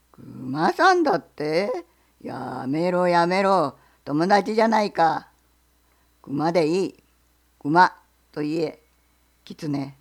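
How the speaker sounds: low, loud, slow gruff question; bear. Acted. Bear asks the fox what he sells, low and loud.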